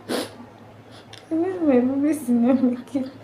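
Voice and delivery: crying as she says it; voice breaking. Real, not acted. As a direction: let the grief crack the voice. Grief over babies' death.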